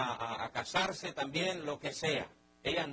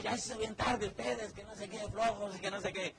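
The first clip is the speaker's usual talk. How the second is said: grumbling father; 6 semitones higher than usual. Acted. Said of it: "gritándole a todo el mundo".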